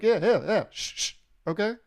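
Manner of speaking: high, loud yeahs sliding into shushing; shushing brush-off. Retold or imitated. Comic to popping microwave, high shushing brush-off. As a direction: hush the microwave like a fussy kid. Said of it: "I'm like".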